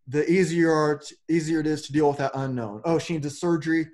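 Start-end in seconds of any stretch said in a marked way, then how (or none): none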